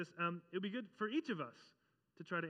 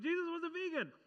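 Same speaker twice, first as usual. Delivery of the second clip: mock claim; 11 semitones higher, 4 dB louder than usual. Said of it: "we go around saying things like".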